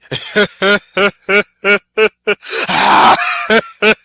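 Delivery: long, loud, high imitated cackle; Eddie Murphy laugh. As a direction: do the famous comedian's big laugh. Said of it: "Eddie Murphy's laugh is easy".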